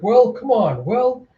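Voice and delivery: high, goading taunt; teasing. Acted. Villain dancing circles round Alita to tease her, teasing. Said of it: "he's trying to tease her".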